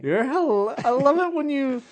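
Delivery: high-pitched gushing woman; woman's voice. Retold or imitated. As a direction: swoon like a smitten woman. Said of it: "my impression was the woman".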